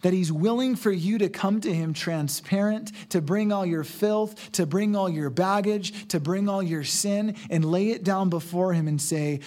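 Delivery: normal talking voice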